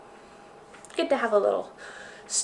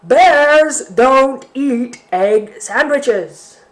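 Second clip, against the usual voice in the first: slow, loud, higher bear; loud bear refrain; 4 semitones higher, 10 dB louder, much slower than usual. Acted. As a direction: stretch every word out. Bear's refrain, bigger each time.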